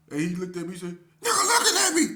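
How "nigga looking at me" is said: high, loud indignant squeal; mock shriek. Cellmate squealing that his roommate stared at him, mock shriek. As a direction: squeal it out, outraged.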